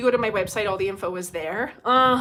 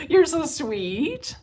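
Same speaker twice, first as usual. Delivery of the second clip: warm; 6 semitones higher, 3 dB louder, much slower than usual. Real, not acted. Thanking a viewer fondly.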